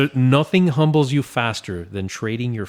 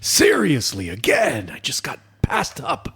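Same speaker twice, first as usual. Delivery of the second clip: mock exasperation; 3 semitones higher than usual. Overlooked giveaway entrant complains to themselves, mock exasperated. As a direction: whine it in exaggerated disbelief.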